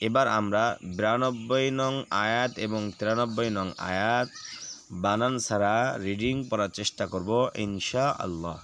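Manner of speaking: ordinary talk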